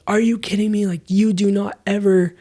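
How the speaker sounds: imitating her freak-out. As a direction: throw her furious disbelief back at him. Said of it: "she freaked out at me like I've never seen her freak out".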